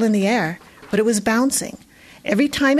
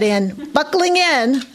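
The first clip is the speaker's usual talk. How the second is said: a lightly voiced roar, drawn out and higher; mild roar; 6 semitones higher, slower than usual. A narrator's reading. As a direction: bellow the order, slowly. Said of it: "the bus driver roared".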